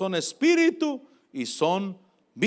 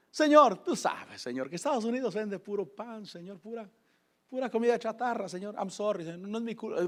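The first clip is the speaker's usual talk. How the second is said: joking excuse; 2 semitones higher, 6 dB quieter than usual. Retold or imitated. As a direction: make light, half-joking excuses in a small voice. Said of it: "a manera de broma, riéndome y excusándome".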